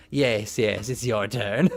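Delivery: goblin voice. Acted. Voicing what the goblin's expression says, low goblin. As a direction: drop low as the creature. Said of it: "it looks like he's like".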